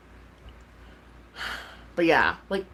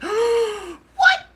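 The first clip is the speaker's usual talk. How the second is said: high-pitched shocked "what"; retold shock; 4 dB louder than usual. Streamer re-enacting reaction to a threat, shocked. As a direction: squeal it in disbelief. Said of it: "I was shook".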